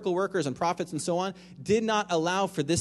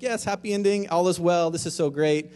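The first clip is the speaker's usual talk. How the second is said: higher and louder, a burst of naive joy; mock excited cheer; 3 semitones higher, 7 dB louder than usual. Preacher voicing the congregation's first naive cheer, brightly excited. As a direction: play the listener's quick relief at the happy ending.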